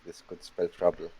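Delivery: soft secret warning; whispering. Acted. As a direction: hush it into their ear. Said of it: "what you say when you whisper".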